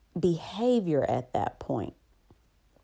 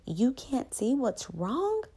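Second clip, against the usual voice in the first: incredulous retort; 8 semitones higher, slower than usual. Retold or imitated. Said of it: "am like".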